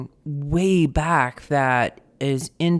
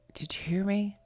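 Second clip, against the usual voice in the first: small, hushed, higher-pitched question; whispered plea; 5 semitones higher, 6 dB quieter than usual. Acted. Seeker asking whether mercy was heard, whispered plea. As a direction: whisper it upward, unsure anyone listens. Voices someone praying for mercy.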